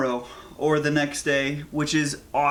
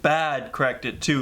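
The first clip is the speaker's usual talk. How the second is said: usual speaking voice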